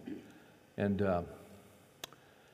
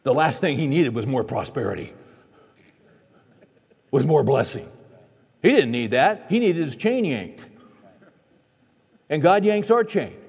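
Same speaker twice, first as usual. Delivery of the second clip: preaching at full force; 6 semitones higher, 5 dB louder than usual. Acted. Preacher urging the congregation to trust God, full force. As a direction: drive the message home to the congregation.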